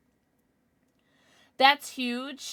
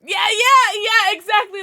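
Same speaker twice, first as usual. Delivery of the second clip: excited agreement; 11 semitones higher, 12 dB louder than usual. Real, not acted. Agreeing loudly with chat.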